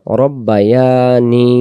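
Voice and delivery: রব্বায়ানি stretched slowly, ni held long; slow recitation. Shown as a demonstration. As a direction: draw out রব্বায়ানি, holding the ni. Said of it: "এই জায়গাটা ভুল হয়".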